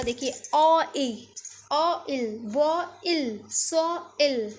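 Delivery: ओ इल slowly split apart; sound it out. Shown as a demonstration. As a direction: break ओ इल into its sounds. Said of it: "इसका sound क्या होगा".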